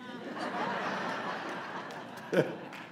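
airy unvoiced laugh after the joke; breathy laugh. Real, not acted. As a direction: let out a soft breathless laugh.

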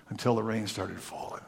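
usual speaking voice

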